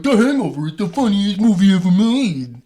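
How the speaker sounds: very loud, high mock raving; hammed-up imitation of overexcited moviegoers; mocking gushing fans. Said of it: "Most people saw The Hangover that summer and were like".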